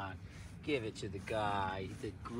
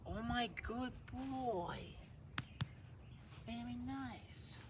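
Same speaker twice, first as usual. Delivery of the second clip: high, slow and softer; soft puppy praise; 12 semitones higher, 11 dB quieter, much slower than usual. Real, not acted. Owner coos praise at her obedient puppy, high and soft. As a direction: coo it gently to the dog.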